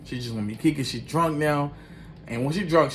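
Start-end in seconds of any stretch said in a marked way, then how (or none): none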